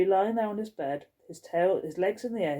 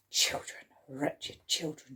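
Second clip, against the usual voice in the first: fuming mutter; 3 semitones lower, 6 dB quieter, slower than usual. Acted. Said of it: "she fumed".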